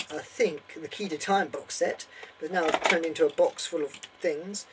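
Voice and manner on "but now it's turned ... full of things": ordinary delivery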